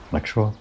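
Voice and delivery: Lexua as two syllables, LEK-shwa, rising as a question; alternative pronunciation. Shown as a demonstration. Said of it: "not sure how else it might be pronounced".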